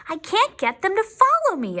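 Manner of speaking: high, lightly wailing train; a touch of a whine; mild mock wail. Train engine complaining to Lamb, mild wail. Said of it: "it wailed".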